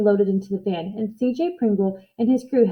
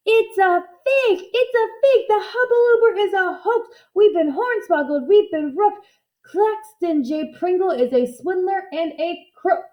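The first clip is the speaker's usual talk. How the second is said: much higher mock crowd yelling; outraged crowd shouting; 8 semitones higher than usual. A narrator's reading. Fooled crowd yelling at the showman, outraged. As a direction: cry out as a cheated mob. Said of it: "burst into an uproar of shouting".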